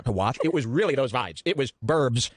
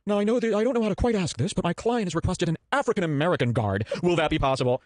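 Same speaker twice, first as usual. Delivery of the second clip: polite booking voice; 6 semitones higher than usual. Retold or imitated. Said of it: "have you ever asked".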